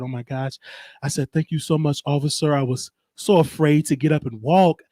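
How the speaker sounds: mock-timid, proper-spoken plea to an officer; Karen act. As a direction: play a meek, apologetic Karen lightly. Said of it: "I put my Karen on".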